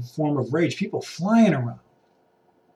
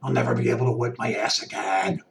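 panicked hoarder voice; 4 semitones lower than usual. Retold or imitated. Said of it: "there was paper towel rage".

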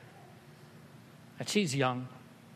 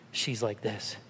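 ordinary delivery

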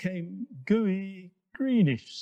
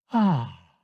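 long satisfied sigh out loud; contented sigh; 5 semitones lower, 7 dB louder than usual. Acted. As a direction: sigh it out with pleasure. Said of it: "He sighed".

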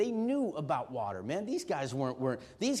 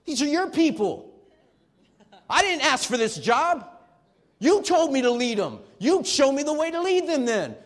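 higher, louder frustrated complaint to God; voicing an exasperated Moses; 9 semitones higher, 10 dB louder than usual. Moses complaining to God about his people, exasperated. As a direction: let Moses vent at God, fed up. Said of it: "Look at Moses".

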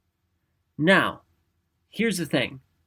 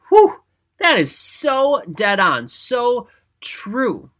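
high, loud, drawn-out agreement; emphatic amazement; 7 semitones higher, 5 dB louder, much slower than usual. Retold or imitated. Speaker recalls reading a striking quote, emphatic amazement. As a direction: stretch it out, struck by how right it is. Reacting to a quote that struck her.

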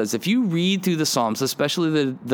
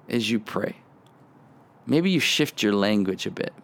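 normal talking voice

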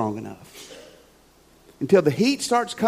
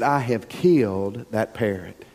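guilty dismay; 9 semitones lower, 3 dB quieter than usual. Retold or imitated. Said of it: "John, who's a tender-hearted man, said".

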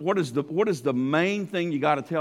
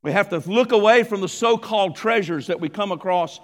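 louder emphatic sermon lines; preaching; 3 semitones higher, 7 dB louder than usual. Acted. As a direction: drive each point home from the pulpit. Sermon delivered at full force.

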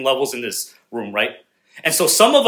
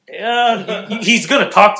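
mock awkward, higher-pitched yeah; introverted impression; 6 semitones higher, 3 dB louder than usual. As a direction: imitate a reluctant introvert, pitched up. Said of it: "He's so introverted. ... kind of like".

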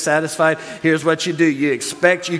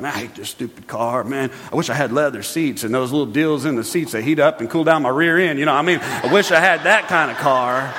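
complaining driver; 6 semitones lower than usual. Retold or imitated. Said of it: "complain about it".

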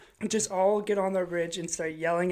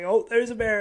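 loud raised call to a bear; yelling at a bear; 4 semitones higher, 7 dB louder than usual. Retold or imitated. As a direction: shout it across the ridge. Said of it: "I just started yelling at it".

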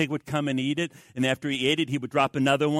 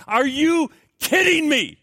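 incredulous outcry; 12 semitones higher, 8 dB louder, slower than usual. Real, not acted. Preacher exclaiming in disbelief.

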